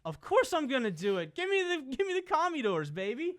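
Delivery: excited, loud underdog pick; hyped shout. Real, not acted. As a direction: shout the pick like a fan at the stadium.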